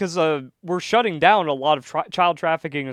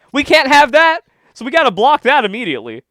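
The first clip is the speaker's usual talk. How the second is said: raised, higher voice, insistent; mock alarm; 8 semitones higher, 8 dB louder than usual. Co-host voices politicians objecting to a release, loud mock alarm. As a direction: blurt it out as an urgent objection.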